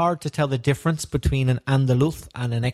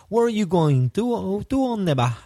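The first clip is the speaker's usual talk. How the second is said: higher-pitched imitation of Extremaduran locals; Extremaduran accent; 7 semitones higher than usual. Teacher imitates people in Cáceres asking the way, high Extremaduran accent. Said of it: "La gente decía".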